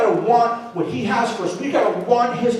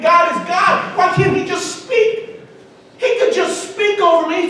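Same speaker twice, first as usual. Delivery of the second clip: frustrated cry; 8 semitones higher, 5 dB louder than usual. Retold or imitated. Man's frustrated plea to God.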